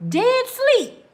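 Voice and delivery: high, loud squeal; high squeak. Retold or imitated. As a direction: squeal it with glee. Woman jumping at the call.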